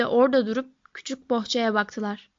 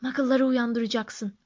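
usual speaking voice

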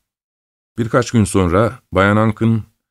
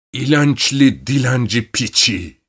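slow, higher-pitched cursing; angry shout; 4 semitones higher, slower than usual. A narrator's reading. Uncle curses his sister's child, shouting angrily. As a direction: spit out the insult slowly and angrily. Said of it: "diye bağırdı".

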